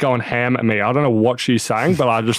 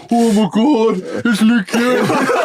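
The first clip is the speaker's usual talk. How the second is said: high mock gushing, stretched out at the end; mimicking starstruck fans; 11 semitones higher, 3 dB louder, slower than usual. Tipsy player echoes fans back at them, mocking squeal. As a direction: parody a starstruck fan, drawing out the name.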